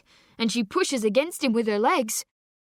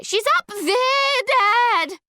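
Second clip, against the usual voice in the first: very high, loud and slow cry; girl shouting to her father, pointing skyward; desperate shout; 7 dB louder, much slower than usual. Acted. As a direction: fling it at him with all she has.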